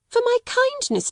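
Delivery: high, mock-gracious headmistress; haughty headmistress. A narrator's reading. Headmistress demands thanks from the orphan, haughtily. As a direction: demand gratitude with cold pride. Narrator voices Miss Minchin.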